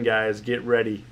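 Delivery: usual speaking voice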